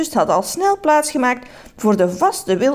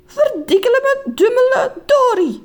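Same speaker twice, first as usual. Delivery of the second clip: high-pitched mock cursing; exasperated cry; 14 semitones higher, 3 dB louder than usual. Snagged man calling out in the tunnel, high exclamation. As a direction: cry out a silly curse, high. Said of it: "riep meneer Just".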